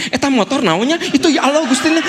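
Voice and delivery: high-pitched fretting in traffic; mock exasperation. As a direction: grumble in alarm at the motorbike, hammed up. Acting distracted driver in traffic.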